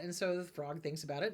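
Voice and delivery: usual speaking voice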